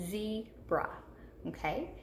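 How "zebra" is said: ZEBRA as two separate beats, ZEE and BRA; syllable by syllable. Shown as a demonstration. Teacher repeating the chin test, ZEBRA split syllable by syllable. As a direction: break ZEBRA after a long ZEE. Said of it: "put your hand under your chin".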